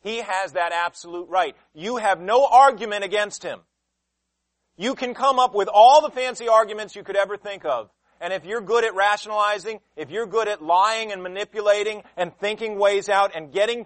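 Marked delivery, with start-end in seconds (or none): 1.7-10.3 loud, insistent preaching. Acted. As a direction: drive it home to the congregation. Preacher at full force.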